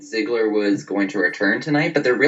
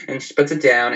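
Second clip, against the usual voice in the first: ordinary delivery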